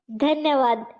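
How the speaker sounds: plain reading voice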